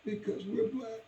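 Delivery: put-on boy and mother in a hushed, sad talk; hushed exchange. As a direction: say it quietly, heartbroken. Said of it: "I said".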